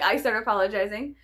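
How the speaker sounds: normal talking voice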